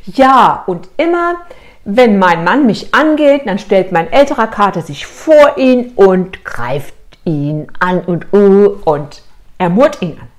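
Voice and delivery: imitating a proud client, loud drawn-out stresses; proud retelling. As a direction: boast slowly about your cat, hammed up. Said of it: "sagt sie noch ganz stolz". (gespielt stolz, laut und gedehnt)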